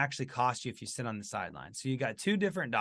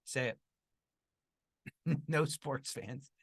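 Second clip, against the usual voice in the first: normal talking voice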